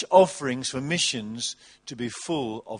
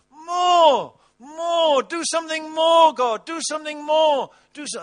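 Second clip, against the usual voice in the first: high, loud, urgent cry of "More! Do something more!"; 11 semitones higher, 4 dB louder than usual. Retold or imitated. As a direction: call out to God for more. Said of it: "a great cry of prayer".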